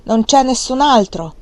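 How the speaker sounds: loud exasperated shout. A narrator's reading. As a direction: hammer the point home. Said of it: "la donna gli gridò nuovamente".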